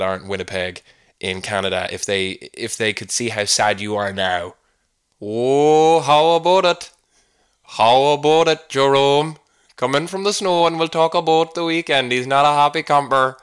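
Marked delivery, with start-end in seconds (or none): 5.2-13.4 hammed-up Canadian accent, loud and higher; butchered Fargo-style Canadian twang; bad Canadian accent. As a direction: put on an exaggerated Canadian accent, badly. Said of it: "My f-, my frame of reference for that accent".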